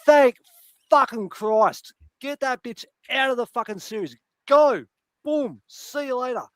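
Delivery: high, loud triumphant cheer; retold cheer at the TV as the hated character dies; gleeful cheer. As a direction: celebrate her death out loud. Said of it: "I was just like".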